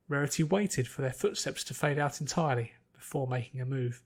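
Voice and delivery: ordinary delivery, nothing marked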